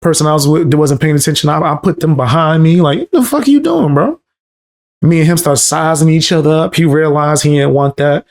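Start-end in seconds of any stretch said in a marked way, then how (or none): 3.0-4.2 sharp high yell at a stranger; "What the fuck are you doing" yelled high; high yelled confrontation. Retold or imitated. Confronting a homeless man.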